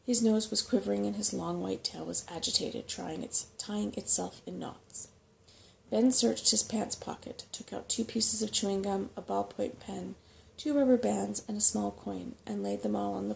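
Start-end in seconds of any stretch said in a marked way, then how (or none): none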